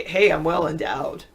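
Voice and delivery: said through a laugh. Pretend. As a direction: chuckle while bragging. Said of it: "Just say".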